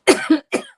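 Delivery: short loud cough. Real, not acted. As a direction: cough out the heartburn. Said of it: "it's a terrible heartburn".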